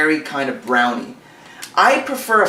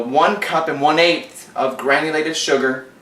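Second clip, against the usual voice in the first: usual speaking voice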